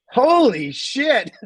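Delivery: high, slow, drawn-out amazement; retold wide-open exclamation of shock; astonished. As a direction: stretch it out in mock astonishment. Retold astonished reaction to the license.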